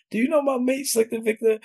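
put-on high-pitched London English accent; London girl. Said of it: "girl from London".

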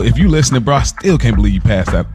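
ordinary delivery

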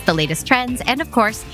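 normal talking voice

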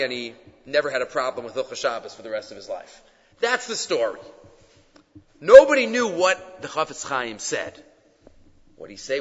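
ordinary talk

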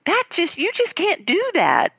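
put-on high-pitched Southern belle accent, teasing; Miss Terry's Southern accent. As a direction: mimic a Southern Alabama lady scolding a bride. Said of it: "Southern accent".

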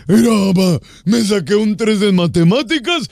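put-on slow, proud little boy voice; mock seven-year-old showing off his grade; kid voice. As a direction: announce it like a kid bragging to mom. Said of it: "Así era, era así".

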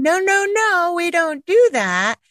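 high, slow, coddling mom voice; gentle, loving parent. Acted. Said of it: "We're trying to be this gentle, loving".

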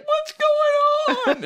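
high, loud, slow dramatic mom voice; mother calling from window, hammed-up dramatic. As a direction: ham up a fretting mother at the window. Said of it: "she's so dramatic".

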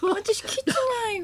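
high, soft, slow mock whine; impressionist's put-on voice. Comedian protesting she was never told, put-on plaintive voice. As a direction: plead softly, drawing it out. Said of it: "何お前モノマネ師みたいになってんだよ".

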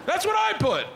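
high, loud-edged excited blurt; excited agreement. Retold or imitated. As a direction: blurt it out, delighted to match. Excited echo of daughter's answer.

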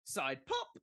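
side pop with a lift on pop; playful rising side pop. Shown as a demonstration. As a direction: bounce up on pop. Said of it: "Is the inflection essential?".

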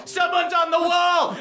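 P=A. Acted. Guard repeats alarm higher.

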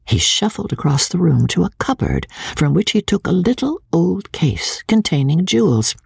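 plain reading voice

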